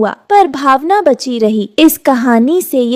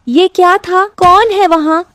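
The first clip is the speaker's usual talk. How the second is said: higher-pitched, alarmed questions; startled awake; 6 semitones higher than usual. Acted. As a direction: jolt awake and call out in alarm. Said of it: "हड़बड़ाकर उठती है". (हड़बड़ाई हुई, ऊँची आवाज़)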